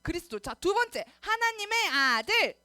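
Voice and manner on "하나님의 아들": slow, loud, raised chant for echoing; loud call-out. Shown as a demonstration. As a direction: stretch it out loudly so the kids repeat. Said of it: "누구 목소리가 큰지 따라해보겠습니다". (크고 느리게 선창하기)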